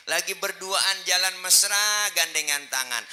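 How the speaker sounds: high, very loud storytelling; impassioned preaching. Real, not acted. Preacher telling the congregation of the Prophet's walk, impassioned preaching. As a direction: project the tale at full force.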